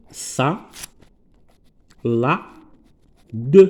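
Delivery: "ça", "la", "de" separated by long pauses; syllable by syllable. Shown as a demonstration. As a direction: sound out "ça, la, de" one syllable at a time. Teacher sounds out word by syllables.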